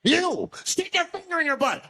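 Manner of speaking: high shrill order; mock unhinged command; crazed demand. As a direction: bark the order like a lunatic. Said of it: "starts making demands".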